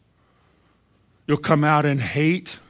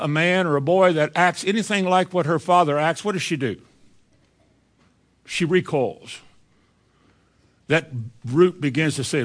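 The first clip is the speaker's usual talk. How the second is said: ordinary talk, nothing marked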